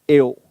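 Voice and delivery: "A O" read out as the two letter names, e then o; the mistaken letter-by-letter reading of "A O"; wrong beginner pronunciation. Shown as a demonstration. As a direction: spell "A O" letter by letter like a beginner would.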